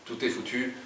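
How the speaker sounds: plain reading voice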